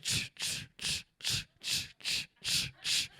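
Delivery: whispered shutter clicks; quiet unvoiced ch-ch shutter noise; shutter sounds. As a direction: softly mimic a camera shutter.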